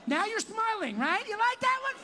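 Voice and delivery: high, loud taunting; mocking tease. Real, not acted. Comic teasing a crowd member, high and mocking. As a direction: needle him about smiling.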